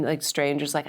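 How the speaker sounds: usual speaking voice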